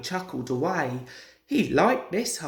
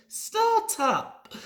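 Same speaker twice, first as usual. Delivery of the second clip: much higher urgent plea; urging. A narrator's reading. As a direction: push him to start, pitched high. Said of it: "he urged".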